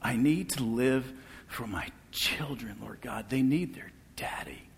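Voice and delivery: hushed, broken plea fading to a whisper; a father's whispered, tearful prayer for his children. Retold or imitated. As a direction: beg softly, voice nearly gone. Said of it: "crying out to God".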